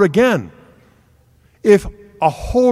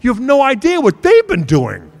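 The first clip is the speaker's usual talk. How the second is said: incredulous alarm; 6 semitones higher, 3 dB louder than usual. Retold or imitated. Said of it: "Kimberly's like".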